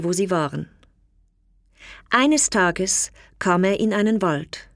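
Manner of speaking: ordinary delivery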